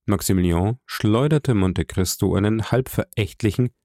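ordinary delivery, nothing marked

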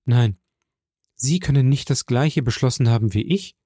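plain reading voice

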